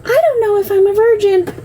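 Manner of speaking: put-on high, small child's voice; a young girl unsure and scared; imitating daughter. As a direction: quote the daughter in a high little voice. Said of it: "All I knew was".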